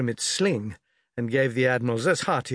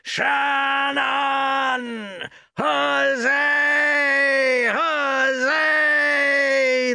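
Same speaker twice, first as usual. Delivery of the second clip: long drawn-out high shout; roaring cheer; 12 semitones higher, 5 dB louder, much slower than usual. A narrator's reading. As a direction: cheer like a crowd of sailors roaring in unison. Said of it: "roaring in unison".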